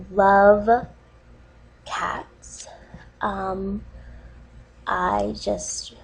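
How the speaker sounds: fighting back tears, barely audible; mock-earnest choked-up whisper; tearful whisper. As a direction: voice choking up into a breathy whisper on cats. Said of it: "sorry, I'm getting emotional".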